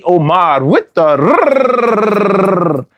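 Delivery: Omar with a long rolled rrrr. Shown as a demonstration. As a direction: roll the R in Omar, drawn out slowly. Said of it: "if you can't say Omar with the rrrr".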